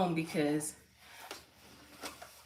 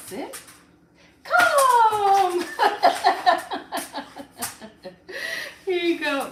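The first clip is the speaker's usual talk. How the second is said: much higher and louder, laughing; excited dog command; 14 semitones higher, 10 dB louder, much slower than usual. Real, not acted. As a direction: laugh and call the dog in a squealing voice.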